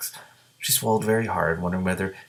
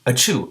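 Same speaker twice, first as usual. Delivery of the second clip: sneeze; 4 semitones higher, 3 dB louder than usual. Acted. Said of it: "Every time the gray green, green dragon sneezed".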